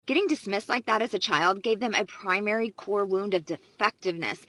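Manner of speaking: normal talking voice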